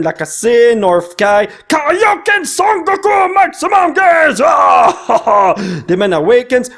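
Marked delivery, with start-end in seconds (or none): 1.7-5.5 hyped attack shout. Pretend. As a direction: bellow the card name like a fighting hero. Calls out anime attack names.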